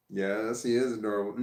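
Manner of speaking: ordinary talk, nothing marked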